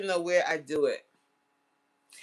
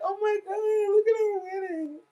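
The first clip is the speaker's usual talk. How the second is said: gushing over pictures; 4 dB louder than usual. Retold or imitated. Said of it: "we were like".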